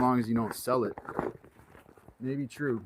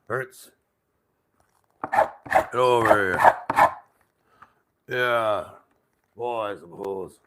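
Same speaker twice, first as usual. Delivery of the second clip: imitating grandpa, slow whiskey-soaked growl; grandfather's whiskey voice; 6 dB louder, slower than usual. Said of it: "That's the way he sounded".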